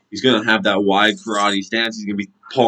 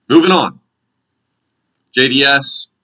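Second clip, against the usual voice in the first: hyped announcement; 7 semitones higher, 6 dB louder than usual. Real, not acted. Loud segment transition.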